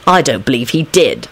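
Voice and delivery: nasty. A narrator's reading. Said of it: "Lupin said nastily".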